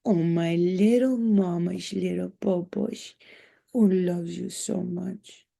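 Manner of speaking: slow, drawn-out cooing to a pet. Real, not acted. Owner fawning over her little dog, slow baby talk. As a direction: coo adoringly, stretching the words out.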